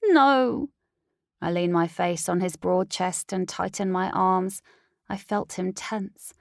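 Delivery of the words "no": high, broken begging; desperate plea. Acted. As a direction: plead with him not to leave. Heroine begs her mate.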